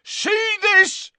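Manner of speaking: very high, loud bellow. Acted. Father showing the official his daughter's chain, bellowing. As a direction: bellow it in fury. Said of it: "he bellowed".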